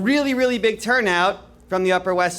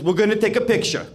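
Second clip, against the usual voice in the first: Cuomo voice; 3 semitones higher than usual. Retold or imitated. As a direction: boss him through the photo op like the governor. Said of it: "He goes".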